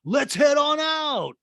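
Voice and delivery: imitating the ski guide; mock Jesse. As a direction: drawl it out up high. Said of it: "And Jesse's like".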